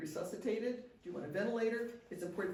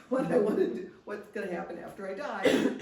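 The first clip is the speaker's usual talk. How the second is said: laughing; 3 semitones higher, 8 dB louder than usual. Real, not acted. Joking about family not listening.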